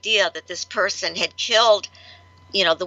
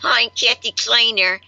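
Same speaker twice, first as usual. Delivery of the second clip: talking through clenched teeth; jaw wired shut; 4 semitones higher, 3 dB louder than usual. Shown as a demonstration. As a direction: speak with teeth locked together. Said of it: "so I could talk like this".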